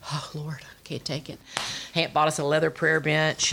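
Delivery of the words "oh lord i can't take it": quiet despair. Retold or imitated. Despairing mother hearing son's confession.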